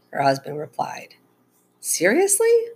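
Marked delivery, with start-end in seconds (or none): 1.8-2.7 very high, rising incredulous question; disbelief. A narrator's reading. Mom to her husband, incredulous. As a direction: swoop up in disbelief.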